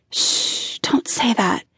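soft whispered hush. A narrator's reading. Woman silencing her lover's talk of death, whispered. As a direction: whisper to hush him gently. Hushing his fear of dying.

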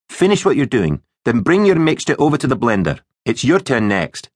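firm, high-pitched teacher's instructions. Acted. Teacher silences and instructs boys.

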